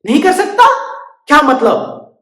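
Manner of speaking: high, loud huffy bird; indignant surprise. Acted.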